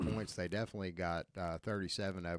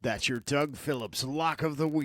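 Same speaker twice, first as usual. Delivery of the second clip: announcer voice; 6 semitones higher, 7 dB louder than usual. Acted. Announcing the pick like a segment.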